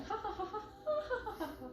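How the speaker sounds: high, tinkling fairy laugh. Retold or imitated. Fairy laughing at the stuck old woman, high giggle. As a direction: giggle like a tiny mocking fairy. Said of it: "she hears a little voice going".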